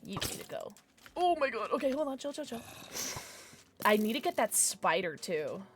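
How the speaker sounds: normal talking voice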